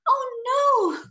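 high, drawn-out storybook "oh no"; puffling's high wail of alarm; dismayed cry. Acted. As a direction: cry out in acted dismay, pitch up then falling. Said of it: "cried the puffling".

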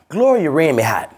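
ordinary talk, nothing marked

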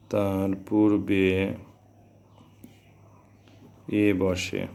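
very slow, broken by long pauses; slow dictation. Shown as a demonstration. Teacher giving students the next rule, slow measured dictation. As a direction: spell out the rule phrase by phrase.

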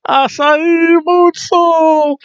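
very high, drawn-out shout; stretched high-pitched wrestling call; commentator's move call. Pretend. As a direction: hold every word long and high, like calling a big move. Calls move like excited commentator.

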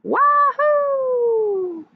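high, loud, long wahoo; exultant whoop. A narrator's reading. Duck thrilled to drive the tractor, whooping. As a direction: let out a soaring, held whoop. Duck's gleeful thought while driving.

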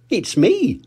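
lightly voiced proud little girl; Susie Sheep. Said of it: "said Susie".

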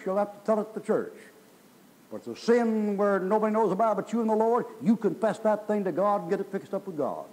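high, pressing pulpit exhortation; preacher driving the point home; insistent preaching. Real, not acted. Preaches at raised pitch.

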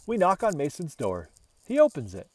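ordinary delivery, nothing marked

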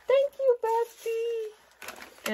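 squealed thanks. Real, not acted. Gushing thanks to her bestie, high delighted squeal.

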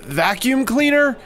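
high, loud silly guy; squeaky nervous customer; high goofy customer voice. Pretend.

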